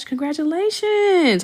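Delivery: high, slow sung-out congratulations; drawn-out congratulations. Real, not acted. Congratulating pregnant viewer Ash, slow high sung-out cheer. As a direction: stretch it out with delight.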